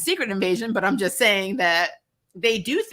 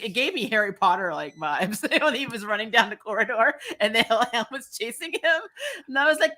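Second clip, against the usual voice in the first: usual speaking voice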